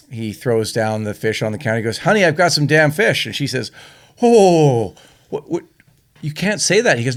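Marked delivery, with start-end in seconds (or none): 2.0-3.3 slightly raised call to his wife; a hint of a cheery husband calling out; lightly voiced priest. Priest calls to wife in joke. 4.2-7.0 mildly hammed-up scandalized wife; lightly voiced, higher-pitched disbelief; acted shock. Shocked wife scolds priest in joke.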